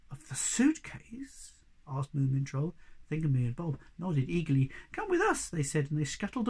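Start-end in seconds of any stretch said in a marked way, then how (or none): 0.1-1.6 slow breathless whisper; whispered question. Acted. Moomin Troll asking about the secret, whispered. Awed question in hiding place. 4.9-5.5 very high squeaky little creature; tiny creature voice. Acted. Thingamy greeting Moomin Troll, high and squeaky.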